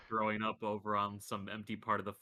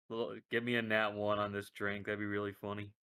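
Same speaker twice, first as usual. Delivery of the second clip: ordinary talk, nothing marked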